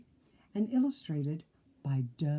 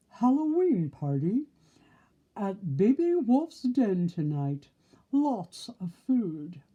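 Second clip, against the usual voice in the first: reading a sign; 3 semitones higher, 5 dB louder than usual. Acted.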